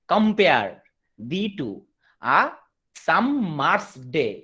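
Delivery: "compare thee to a summer's day" in slow, separated beats; syllable-by-syllable scansion. Shown as a demonstration. Teacher showing students the ten syllables, "compare thee to a summer's day" slowly measured. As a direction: pause between each foot of "compare / thee to / a / summer's day". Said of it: "দশটা করে syllable থাকে".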